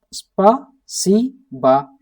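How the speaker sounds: Спасибо drawn out slowly, syllable by syllable; slow demo. Shown as a demonstration. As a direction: stretch out Спасибо for learners. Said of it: "like that".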